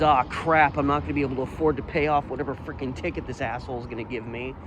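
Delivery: fast, rattled-off grumble; grumbling inner voice. Retold or imitated. Driver fretting about a ticket, fast grumble. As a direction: rush the annoyed grumble out. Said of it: "the worst thing I've ever had to fear".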